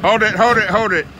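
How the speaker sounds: loud urgent stop call. Real, not acted. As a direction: stop them now. Stopping the driver.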